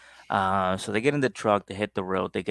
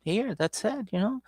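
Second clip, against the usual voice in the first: put-on groggy boyfriend; 13 semitones higher, 3 dB quieter than usual. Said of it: "He's like".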